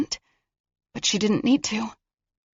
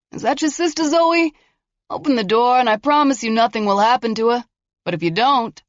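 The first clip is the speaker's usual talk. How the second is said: higher, mocking taunt; taunting killer; 5 semitones higher, 3 dB louder than usual. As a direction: sneer at her bluff. Killer threatening through door.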